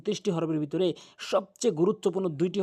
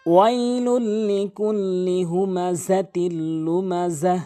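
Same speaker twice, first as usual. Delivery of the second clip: slow melodic tajweed chant; Quran recitation; 2 semitones higher, 4 dB louder, much slower than usual. Shown as a demonstration. Teacher opens the lesson with the surah, slow melodic recitation. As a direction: recite verses with long drawn-out vowels.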